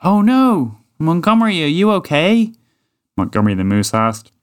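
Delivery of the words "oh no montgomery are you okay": worried. Acted. Moose finds friend buried.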